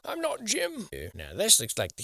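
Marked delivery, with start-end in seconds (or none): 0.0-0.9 very high voice, I'm not Jim; squeaky Jim reply. Pretend. Jim denying he is Jim, squeaky voice. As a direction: squeak the denial.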